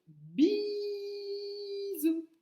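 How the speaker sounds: Bisous drawn out high with a long held I; stretched I sound. Shown as a demonstration. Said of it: "C'est le son I".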